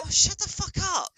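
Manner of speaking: high, put-on voice; mock irritated dismissal; exasperated retort. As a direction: snap it at the anime characters. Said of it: "It's like".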